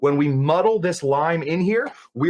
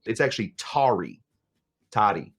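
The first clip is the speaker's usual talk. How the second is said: ordinary talk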